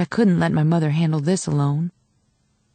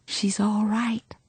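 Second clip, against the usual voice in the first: slow soft whisper; 3 semitones higher, 4 dB quieter, much slower than usual. A narrator's reading. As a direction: reassure her gently, barely voiced. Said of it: "I leaned over to whisper".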